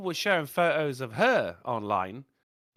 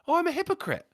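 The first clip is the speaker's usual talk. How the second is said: higher, mock girlfriend's voice; girlfriend's admission; 10 semitones higher, faster than usual. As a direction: chirp it like the girlfriend caught out. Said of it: "And she went".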